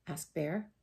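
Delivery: ordinary delivery, nothing marked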